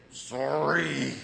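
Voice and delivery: long drawn-out, reluctant sorry; grudging kid apology. Retold or imitated. Kid told to say it like they mean it, grudging. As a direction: imitate a child forced to apologize. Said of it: "Say it like you mean it".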